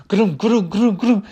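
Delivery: repeated rolling grooms; rumbling noises. Retold or imitated. Storyteller imitates the truck still rolling downhill, rumbling noise. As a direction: rumble along in short bursts.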